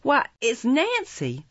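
high, slow gasp of recognition; astonished exclamation. A narrator's reading. Oliver recognising the young woman, high astonishment. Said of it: "in irrepressible astonishment".

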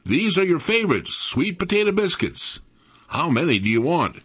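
lightly voiced mother. A narrator's reading. Mother offering her son favorite biscuits, lightly voiced. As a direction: lift the pitch, offering a child a treat.

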